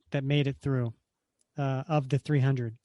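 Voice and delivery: ordinary talk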